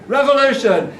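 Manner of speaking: high, ringing protest call; rallying cry. Acted. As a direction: call it out to a crowd. Rallying cry in performed poem.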